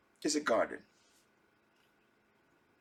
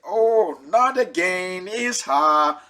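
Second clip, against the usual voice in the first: louder, drawn-out mock dismay; exasperated husband; 6 dB louder, slower than usual. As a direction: drag it out as a fed-up husband. Husband dreads hearing wife again.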